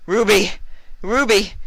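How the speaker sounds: high, loud call across the room. A narrator's reading. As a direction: shout her name to find her. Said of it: "she called out".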